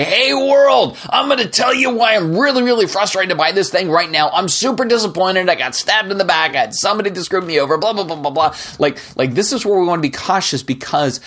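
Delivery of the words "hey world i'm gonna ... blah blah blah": a parody of venting grievances on social media; mock online rant. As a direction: whine it out to the whole internet like a wounded poster. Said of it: "go on Twitter, Facebook, or whatever and say".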